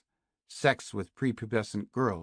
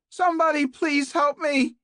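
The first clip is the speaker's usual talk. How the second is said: much higher and louder, screaming for help; desperate scream; 10 dB louder than usual. A narrator's reading. Captive woman screams through the walls for help, full scream. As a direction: scream it at the top of your lungs. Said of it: "screaming at the top of her voice".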